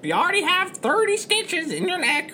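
squawking, needling parrot. Acted. Parrot reminds captain of his stitches, squawking. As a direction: screech it like a cheeky bird. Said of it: "Pickles the parrot".

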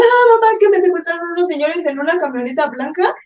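high, loud fake-crying kidnap victim; hammed-up tearful phone plea; mock crying. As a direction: imitate a scammer wailing for mamá. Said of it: "Entonces te hablan llorando".

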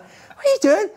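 very high incredulous What are you doing; baffled question. Retold or imitated. Teammate's baffled question retold.

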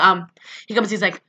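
normal talking voice

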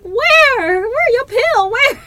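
loud, high-pitched comic panic; retold panic. Retelling own reply to the partner's yell, hammed-up panic. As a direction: yelp the questions in mock alarm. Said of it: "I was in a panic".

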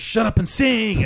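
high, slow mock command; bossy order. Imitating the drunk chef ordering him to sing, slow and high. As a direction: bark it like a pushy host. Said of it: "He goes".

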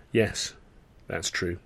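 soft, guarded agreement; careful reply. A narrator's reading. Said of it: "Tilly said carefully".

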